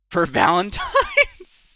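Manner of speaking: high, loud, drawn out through laughter; laughing. Real, not acted. Streamer reacting to chat's Valentine's idea, laughing incredulously. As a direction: crack up halfway through the question.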